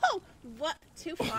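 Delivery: loud laughing outburst; laughing shock. Acted. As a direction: burst out laughing in disbelief. Shocked at the pounding story.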